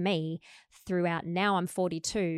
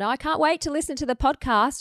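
mock yell; 8 semitones higher, 6 dB louder than usual. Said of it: "yells out in the background".

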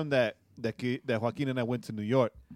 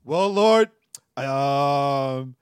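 mock prayer; 9 dB louder, much slower than usual. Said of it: "what I really wanted to say was".

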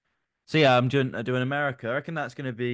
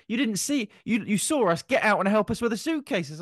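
a whiny, much higher imitation of a friend's complaint; hammed-up grumbling, pitched way up; mock complaint; 12 semitones higher than usual. Friend imitating a mate complaining about the suitcases, whiny mock complaint. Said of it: "You said".

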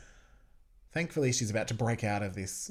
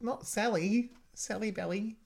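high-pitched imitation of a show heroine; mock Xena voice; 11 semitones higher than usual. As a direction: voice her in a high, put-on pitch. Voicing Xena protecting her horse.

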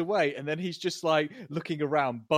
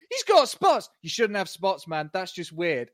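high, loud jeering cry; mocking exclamation; 4 semitones higher, 7 dB louder than usual. The gang jeers at Frank's spots, a high loud mocking yell. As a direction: shout it out to make fun of him.

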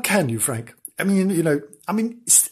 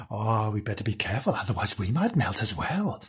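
hushed fearful; 5 semitones lower, 9 dB quieter than usual. Acted. Fearful aside in story.

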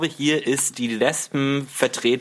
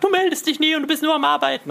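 high, fast nagging partner voice; mock nagging wife; faster than usual. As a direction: whine like a neglected wife, quick and high-pitched. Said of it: "die weibliche Rolle einnimmt und sagt".

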